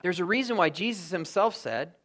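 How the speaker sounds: usual speaking voice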